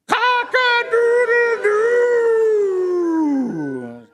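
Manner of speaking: long, high, loud crow; full rooster crow. A narrator's reading. Rooster wakes everybody at sunrise, long loud crow. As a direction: hold the crow, then fall away. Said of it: "the rooster crows".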